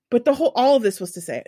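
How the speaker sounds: ordinary talk, nothing marked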